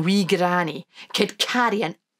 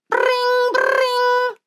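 phone ring; 5 dB louder than usual. Acted. Phone ringing sound imitated.

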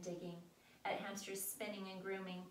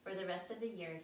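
ordinary delivery

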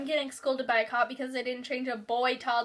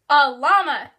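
much louder repeat of "A llama"; emphatic repeat; 3 semitones higher, 13 dB louder, slower than usual. Acted. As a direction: say "llama" loud and drawn out. Repeats louder after being asked what.

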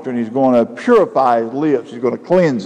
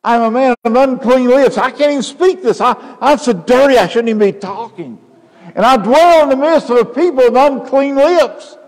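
much higher, louder desperate cry; anguished confession; 10 semitones higher, 3 dB louder than usual. Acted. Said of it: "he said".

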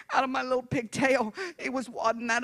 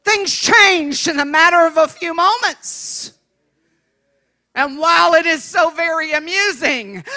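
normal talking voice

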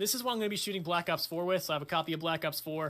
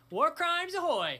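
much higher, louder, slow bellowed catchphrase; loud shout; 11 semitones higher, 5 dB louder, slower than usual. Shown as a demonstration. Shouting the channel catchphrase, a loud slow bellow. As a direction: belt it out like a battle cry. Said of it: "I thought that was gonna hit my computer".

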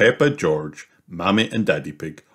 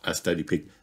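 ordinary delivery

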